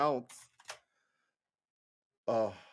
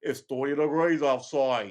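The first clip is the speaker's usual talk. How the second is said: loud caricature of a British or Australian bloke; mock British-Australian accent; 2 semitones higher, 5 dB louder than usual. Host imitating British and Australian YouTubers, mock British-Australian accent. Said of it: "British people and Australian people".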